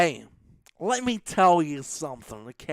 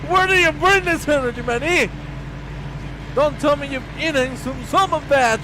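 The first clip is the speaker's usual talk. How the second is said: loud, high astonished reaction; fast, high-pitched amazement; amazed excitement; 10 semitones higher, 10 dB louder than usual. Real, not acted. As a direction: react blown away by the energy. Amazed at co-commentator's outburst.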